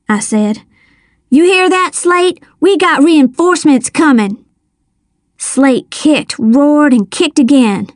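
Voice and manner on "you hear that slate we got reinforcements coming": high, bold taunt. A narrator's reading. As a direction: jeer down at the trapped man. Taunting trapped man.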